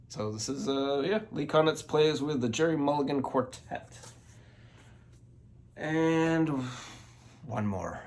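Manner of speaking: slightly winded while talking; out of breath. Real, not acted. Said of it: "I'm out of breath".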